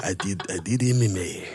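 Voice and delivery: badly faked Spanish accent, slipping toward African; attempted Spanish accent. Comic retrying the father's line, low faked Spanish accent. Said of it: "Do Spanish accent".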